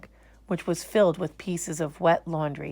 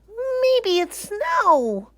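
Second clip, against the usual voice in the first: very high, slow thrilled bear; excited; 6 dB louder, much slower than usual. Acted. Bear thinking the soap flakes are snow. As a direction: gasp with wonder. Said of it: "he said excitedly".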